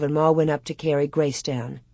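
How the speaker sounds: plain reading voice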